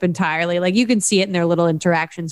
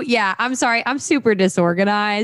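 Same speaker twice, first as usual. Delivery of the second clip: ordinary delivery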